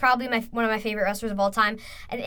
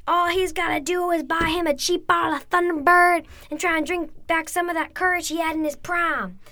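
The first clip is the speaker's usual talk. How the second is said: imitating Stone Cold; 6 semitones higher than usual. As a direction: taunt the old preacher, building to the punchline. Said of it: "And Austin said".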